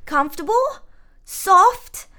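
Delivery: high, incredulous echoed questions, climbing with each word; voice rising. A narrator's reading. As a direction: repeat it in rising disbelief. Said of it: "Katrina's voice rose with each word".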